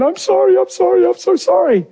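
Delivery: very high, pleading mock sob; imitated crying apology. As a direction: retell a frantic, tearful stream of apologies. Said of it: "And she's crying".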